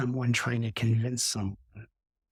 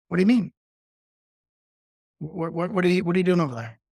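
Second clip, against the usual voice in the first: agitated confrontation; 5 semitones higher, 4 dB louder, much faster than usual. Retold or imitated. Retold agitated person confronting him.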